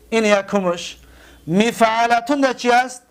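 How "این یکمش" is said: usual speaking voice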